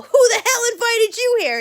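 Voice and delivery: high, loud indignant demand; mock angry. Coaching brother to scare off the girl upstairs, mock angry.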